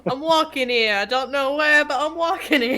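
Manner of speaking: mock New Yorker. Player teasing the GM, mock New York shout. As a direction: ham up the New York cabbie.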